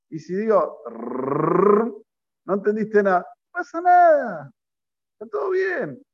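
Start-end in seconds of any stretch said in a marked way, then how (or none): 0.9-1.9 long rolled R noise; rolled trill. Retold or imitated. Gibberish rattled off as prayer. 3.2-6.0 exaggerated mock reassurance; mock complacency. Mimics complacent excuse.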